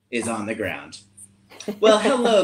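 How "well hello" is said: high, loud welcoming greeting; cat counselor. Acted. Cat counselor greeting campers up close, high and loud.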